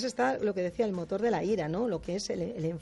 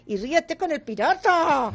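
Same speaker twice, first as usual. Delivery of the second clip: angry mother's order; 8 semitones higher, 7 dB louder, slower than usual. Retold or imitated. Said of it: "oigo una madre que le dice".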